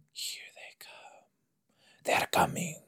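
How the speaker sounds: faint whisper, barely there; hushed warning. Pretend. Viewer announcing who's coming on screen, whispered. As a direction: whisper it as if they might hear. Mock-ominous warning while watching.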